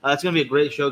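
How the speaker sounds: usual speaking voice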